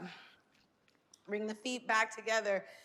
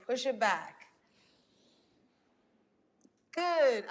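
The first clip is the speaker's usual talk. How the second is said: ordinary talk, nothing marked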